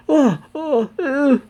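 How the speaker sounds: very high, squealed cries of horror; hammed-up horrified crowd noises; shock and horror. A narrator's reading. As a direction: squeak out mock-horrified reactions. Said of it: "look on in shock and horror".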